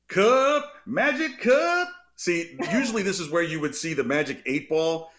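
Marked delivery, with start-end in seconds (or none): 0.1-1.9 high, slow drawn-out segment announcer; announcer hype. Pretend. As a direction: call out the segment name like a game-show host.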